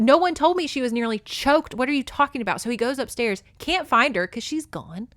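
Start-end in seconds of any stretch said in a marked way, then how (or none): 0.0-2.6 higher, louder mock disbelief; retold indignation. Derek hearing she was nearly choked, retold indignantly. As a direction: act out his baffled protest.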